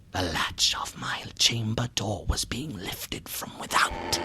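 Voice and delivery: terrified whisper, barely audible; frozen whisper. A narrator's reading. Narrator whispering as the door latch lifts. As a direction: hold your breath as you tell it.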